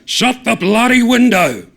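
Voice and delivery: high, loud, angry bellow; yell. A narrator's reading. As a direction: shout across the pub. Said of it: "yells Don".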